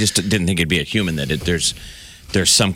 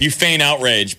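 ordinary talk

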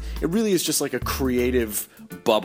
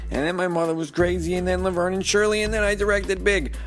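high, put-on nasal New York voice; Penny Marshall; 10 semitones higher than usual. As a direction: read it like an aging New Yorker. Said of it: "wanna hear Penny Marshall's voice reading".

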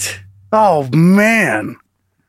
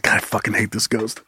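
low, muttered grumble under his breath; muttered annoyance; 6 semitones lower, 8 dB quieter than usual. Pretend. Annoyed man muttering about the ghost, low grumble.

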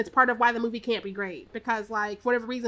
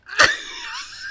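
a sudden high shriek of laughter. Real, not acted. Friends recapping a movie, laughing in a high shriek. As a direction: burst out in a high cackle.

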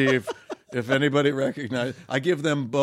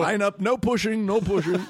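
put-on high-pitched old man voice; put-on high voice of an aged celebrity; 8 semitones higher than usual. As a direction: fuss at a crowd like an old man. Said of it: "That's me as an old man".

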